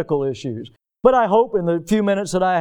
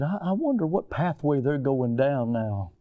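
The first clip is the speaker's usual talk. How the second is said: hushed worry; 5 semitones lower, 7 dB quieter than usual. Acted.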